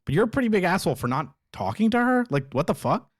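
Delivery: exasperated call-out; indignant. Real, not acted. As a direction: fire it off angrily. Indignant rant.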